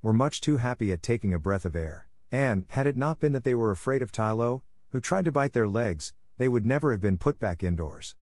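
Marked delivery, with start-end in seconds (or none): none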